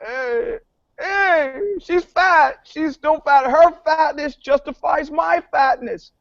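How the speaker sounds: high, excited hammed-up crowd voice; cartoonish public, high and gushing; mock cheering public. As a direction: cheer like a gleeful fan who feels vindicated. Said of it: "the American public will be like".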